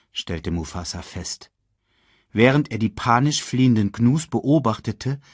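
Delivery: usual speaking voice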